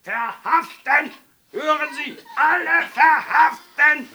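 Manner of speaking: high, very loud, drawn-out bellow; furious official yelling orders; shouted order. A narrator's reading. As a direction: bellow the command at full voice, stretching each word. Mayor orders arrests, shouting.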